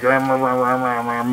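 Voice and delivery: muffled mumble round a cigar; mumbling. Shown as a demonstration. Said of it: "mumbling and stuff".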